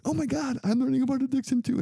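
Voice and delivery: put-on girl delighted to find a classmate, squealing; excited girl. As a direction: gush like a thrilled young woman. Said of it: "she's like".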